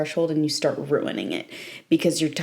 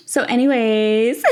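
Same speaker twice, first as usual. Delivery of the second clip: ordinary talk, nothing marked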